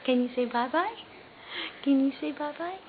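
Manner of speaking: ordinary talk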